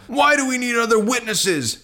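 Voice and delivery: very high, indignant exclamation; outraged outcry. Acted. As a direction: cry out as the scandalised council. Outraged council condemns Jesus.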